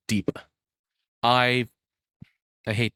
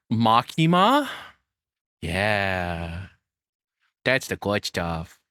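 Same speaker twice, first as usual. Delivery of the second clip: mock swoon; much slower than usual. Streamer mock-swooning over an anime character. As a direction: savor it like a guilty treat.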